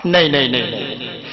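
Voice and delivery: dropping low and soft in mock dismay; tut-tut at the quiet crowd, trailing to nothing; fading disappointed no. Real, not acted. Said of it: "جس طرح میں پڑھ رہا".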